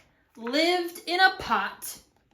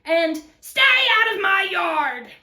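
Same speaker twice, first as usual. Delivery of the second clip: high, loud warning; angry yell; 6 semitones higher, 7 dB louder than usual. Acted. Cactus to jackrabbit, yelling back angrily. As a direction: yell them off your turf. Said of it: "Hank yelled back".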